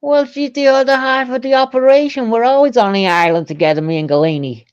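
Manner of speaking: mock American, starting high and falling to normal pitch; Jeffrey Epstein impression. In character, describing his partner on the island, mock American. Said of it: "That's my accent for G".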